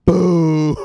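a sudden loud high shout; loud cheer. Real, not acted. Host hyping the V-Chain deal to viewers, loud shout. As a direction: blast it out like a hype call.

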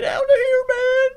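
a sudden high-pitched cry of get out; high yell. Acted. Telling a game character to get out, high yell. Yelling someone out of his life.

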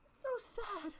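high, tearful voice, crying; real crying. Real, not acted. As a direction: say it through real tears. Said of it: "I don't know if you can see the tears".

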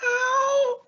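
high little cartoon yelp; mock ow. Puppet character cries out.

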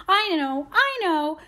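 high-pitched, triumphant outburst; excited cry. A narrator's reading. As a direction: cry it out as if the secret is found. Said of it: "she cried".

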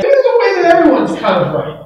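high, lilting parody of agreement; mock agreeable voice. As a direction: gently send up the everyone-wins attitude. Mimics the everyone-is-right idea.

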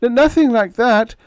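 high, stammering, caught out; flustered stammer. A narrator's reading. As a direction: gobble out a nervous denial. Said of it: "gobbling like a block-bound turkey".

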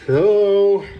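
talking around a pencil; words muffled by a pencil; pencil in mouth. Real, not acted. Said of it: "got a pencil in my mouth".

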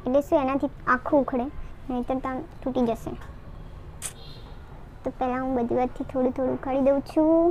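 stiff dried mask on cheeks; talking while peeling mask; wearing a peel-off face mask. Real, not acted.

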